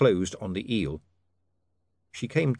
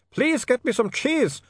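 higher, slow Ubervaltian begging; desperate pleading; 4 dB louder, slower than usual. Acted. Otto begging Saccharissa for cheese, desperate. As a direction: plead as if starving.

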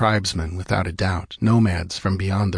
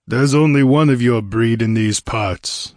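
usual speaking voice

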